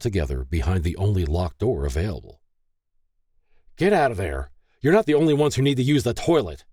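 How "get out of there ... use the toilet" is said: fast, higher-pitched angry order; hot demand. A narrator's reading. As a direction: demand it heatedly through a locked door. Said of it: "David hotly demanded".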